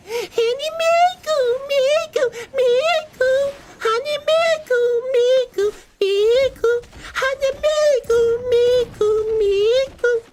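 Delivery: put-on very high, falsetto girly [name] voice; [name] impression. Streamer doing his best [name] impression in falsetto. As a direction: squeal the name like a pop idol. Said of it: "my best woman impression and my best [name] impression".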